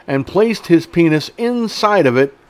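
normal talking voice

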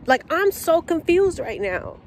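high, incredulous complaint; can't believe this is happening; exasperated disbelief. Real, not acted. Venting to viewers about the missing order, high and disbelieving.

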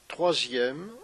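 unhurried meditation guide with long pauses; guided meditation. Acted. Yoga teacher guiding listeners through a body scan, guided meditation.

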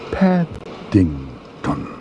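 Paddington split slowly into Pad, ding, ton; very slow, savoured Paddington in separate beats; syllable by syllable. Acted. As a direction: stretch Paddington out with gaps between syllables. Bear savours name syllable by syllable.

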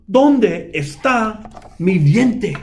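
high, slow, strained mouse voice; searching mouse voice. A narrator's reading. El Ratón Pérez searching the shoes, fretful. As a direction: hunt and fret aloud.

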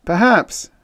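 high, loud pronouncement; solemn. A narrator's reading. Officer weighing in on outpost man's attitude, solemn. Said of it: "Murchison said solemnly".

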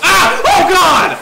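loud, high yelp of shock; panicked high shriek; startled scream. Real, not acted. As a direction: scream as if jumped from nowhere. Startled by game attack.

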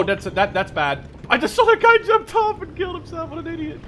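high, loud disbelieving exclamation; loud surprised outburst. Real, not acted. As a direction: shout in real shock at a teammate's blunder. Sees teammate jump to death.

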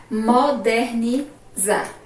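broken into slow syllable groups; slow dictation. Shown as a demonstration. Teacher dictating to the class, slow broken syllables. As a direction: pause inside "Modernizar" as you dictate.

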